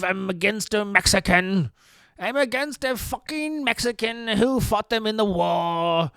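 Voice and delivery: hammed-up Cockney with drawn-out vowels; Cockney Steve Hilton. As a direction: put on an exaggerated, slow Cockney accent. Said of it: "this fucking Cockney bullshit".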